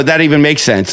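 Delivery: ordinary talk, nothing marked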